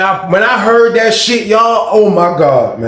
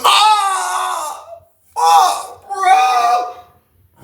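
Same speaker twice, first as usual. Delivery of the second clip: very high pained howl; pained cry; 14 semitones higher, much slower than usual. Retold or imitated. Guy being beaten, pained cry. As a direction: cry out in pain. The noise heard through the door.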